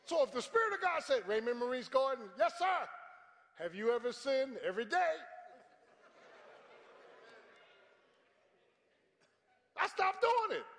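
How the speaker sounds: high, loud, ringing preacher voice; preaching. Real, not acted. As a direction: drive the point home to the pews. Preaching at full force.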